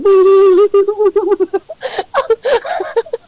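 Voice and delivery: long high-pitched wheeze of laughter; retold helpless laughter. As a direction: mimic yourself crying with laughter. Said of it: "fucking, I was like".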